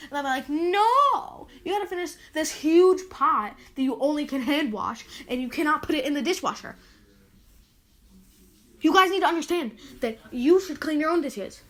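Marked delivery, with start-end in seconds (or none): 0.5-6.8 loud, high, annoyed telling-off; angry retort. Retold or imitated. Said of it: "Sorry, getting a little angry". 8.8-11.6 louder, scolding the listeners; angry lecture. Real, not acted. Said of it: "Sorry, getting a little angry".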